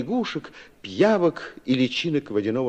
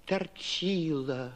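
sighing plea; 8 dB quieter than usual. A narrator's reading. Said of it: "лягушки вдруг все враз вздохнули".

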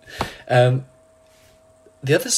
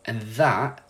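ordinary talk

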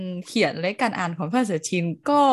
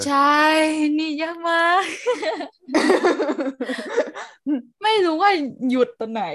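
laughing; 7 semitones higher, 4 dB louder than usual. Real, not acted. Laughing at hard Thai spelling.

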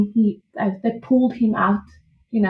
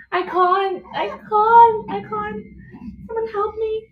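much higher, crying I can't; imitated desperate cry; 11 semitones higher, slower than usual. Mother retelling transition, crying plea. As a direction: plead like you're breaking in transition.

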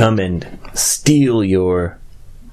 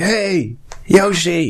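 high-pitched mock holler; imitated yelling; 8 semitones higher, slower than usual. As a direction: pretend to call across a long distance. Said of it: "すごい大きい声で怒鳴ってんの".

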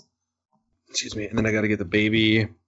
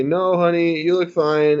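reassuring parent; 8 semitones higher, 7 dB louder, slower than usual. Retold or imitated. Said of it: "And you say".

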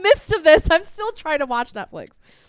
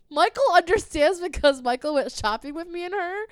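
ordinary talk, nothing marked

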